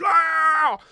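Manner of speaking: sudden high yelp of frustration; mock frantic scream. Elon losing his mind in the shouting match, high mock scream. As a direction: shriek it like someone losing it mid-argument. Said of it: "losing his fucking mind".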